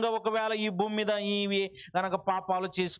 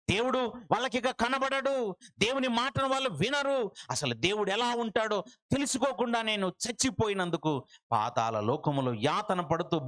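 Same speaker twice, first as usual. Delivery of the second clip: normal talking voice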